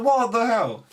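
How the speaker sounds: exasperated what the hell. Retold or imitated. Fed up with the opponent's loop, high exasperated outburst. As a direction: throw up your hands in outrage.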